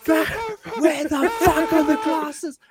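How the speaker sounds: high, slow, strained mock yell; hammed-up high-pitched outburst; furious bartender. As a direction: burst in demanding, comically enraged. Acted bartender kicking kitchen door open.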